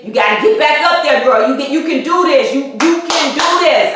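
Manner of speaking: rally yourself like a coach, quick and insistent; self pep talk. Retold or imitated. Retold pep talk to herself.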